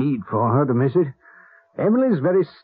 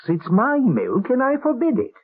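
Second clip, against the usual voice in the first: stubborn master of the house; indignant refusal; 8 semitones higher than usual. Acted. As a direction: lay down the law, outraged. Quarrel over feeding the boy.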